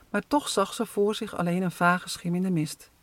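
ordinary delivery

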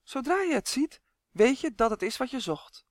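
high, confident insistence; firm assertion. A narrator's reading. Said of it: "beweerde Malien stellig". (stellig, met hoge stem)

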